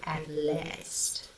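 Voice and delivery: at LAST said as "at lest"; soft "at last" with an E in last; E vowel in last. Shown as a demonstration. Reviewer shows how she sings it, last with an E. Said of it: "she sings it with an E".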